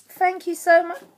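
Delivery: high, touched thanks ending in a gasp; gonna-cry gratitude. Real, not acted. Vlogger thanking her friend for a planner, overwhelmed. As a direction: thank them as if about to cry. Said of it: "I'm gonna cry".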